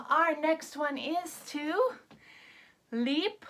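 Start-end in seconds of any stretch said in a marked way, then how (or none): none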